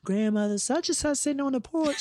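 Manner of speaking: high-pitched mock tattletale call; tattling child. Child reporting porch sitters to grandmother, high tattling voice.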